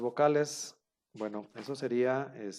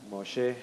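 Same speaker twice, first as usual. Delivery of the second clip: MOSHE stressed on the final syllable, mo-SHE; acento agudo; 4 dB louder than usual. Shown as a demonstration. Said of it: "con el acento en la última sílaba". (moshe con el acento al final, moshé)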